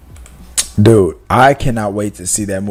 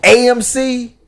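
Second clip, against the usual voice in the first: mock sales pitch; 10 semitones higher than usual. Streamer hawking his spare ticket to viewers, mock sales pitch. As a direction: sell it like a cheesy ad.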